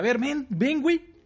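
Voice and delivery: high, sharp call to a student; stern teacher call. Retold or imitated. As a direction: call the kid over sternly. Teacher calling student over.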